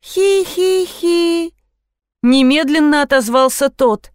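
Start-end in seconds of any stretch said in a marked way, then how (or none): 0.0-1.5 high, slow monotone "hee-hee-hee"; eerie toy giggle. A narrator's reading. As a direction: giggle like a spooky toy, drawn out. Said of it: "безостановочно издавала Лолита". (жуткое протяжное хихиканье)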